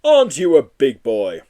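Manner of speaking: loud jolly Santa. Acted. Santa greeting a big visitor, jolly loud voice. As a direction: boom it like Father Christmas. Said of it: "said Santa".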